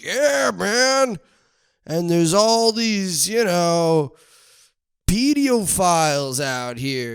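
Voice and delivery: high, drawn-out goofy voice; mock sauna guy. Mocking last week's sauna guy to viewers, goofy voice. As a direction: send up the guy's gloomy remark.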